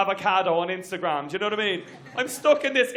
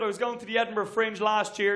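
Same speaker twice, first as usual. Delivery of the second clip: ordinary talk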